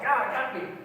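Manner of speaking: high, loud pained yell. Retold or imitated. Dad stung on the neck, yelling out. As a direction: cry out as the bee stings. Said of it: "he yelled out".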